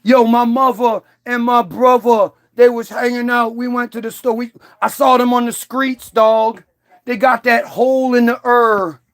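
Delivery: mocking street-slang thug accent. Speaker parodying street talk to his audience, thug accent. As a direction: exaggerate a thug accent, "brovva" and "hurr". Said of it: "thug accent".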